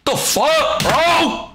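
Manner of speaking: high, wailed exclamations; real gamer's cry of frustration; dismayed cry. Real, not acted. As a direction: wail each curse in dismay, pitched high. Upset at losing in the game.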